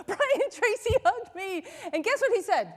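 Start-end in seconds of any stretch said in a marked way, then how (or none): 0.1-1.6 higher, drawn-out excited announcement; thrilled, high-pitched squeal of news; excited retelling. Retold or imitated. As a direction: gush the news with a laugh in it. Retelling her excited news to husband.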